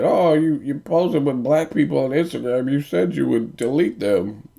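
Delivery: high, whining mock complaint; whiny old man. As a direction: whine it like a jealous old man. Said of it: "you're like".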